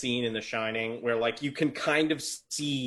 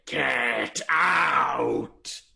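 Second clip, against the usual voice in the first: ghost shouts, louder and eerie; ghostly shout; 5 dB louder than usual. Acted. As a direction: bellow it like an angry ghost.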